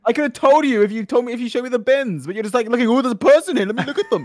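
loud, high, scolding rant; exasperated rant. Real, not acted. Player blaming partner for hiding the bins, exasperated. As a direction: rail at them in heated frustration. Berating partner after failed guess.

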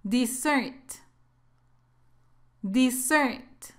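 "Dessert" as di-ZERT, stress on the second syllable; short first vowel, strong ending in "Dessert"; careful teacher's model of "Dessert", stressed at the end; double-S pronunciation. Shown as a demonstration. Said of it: "la pronunciación es así".

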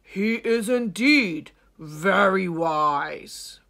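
put-on loud, booming old lion; old lion Claw. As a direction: answer with a lion's weight. Claw replies.

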